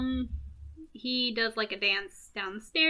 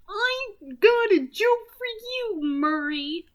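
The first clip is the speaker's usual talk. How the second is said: high, drawn-out singsong taunt; taunting Joker; 9 semitones higher, 5 dB louder, slower than usual. Acted. Joker sets up his deadly joke for Murray, taunting. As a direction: stretch it out high and loud.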